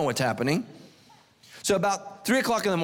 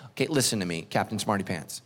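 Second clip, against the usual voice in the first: usual speaking voice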